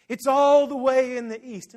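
slow, high, loud declaration; jubilant proclamation. Retold or imitated. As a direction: stretch it out triumphantly. Proclaiming forgiveness as Jesus.

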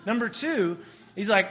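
normal talking voice

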